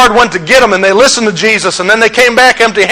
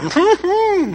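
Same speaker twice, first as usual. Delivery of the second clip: soft high giggle; 9 semitones higher, 8 dB quieter than usual. Real, not acted. Laughs at own joke.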